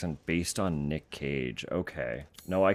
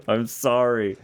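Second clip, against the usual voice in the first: loud, drawn-out apology; 4 semitones higher, 9 dB louder, slower than usual. Real, not acted. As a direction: apologise loudly and slowly. Apologising after ad jump scare.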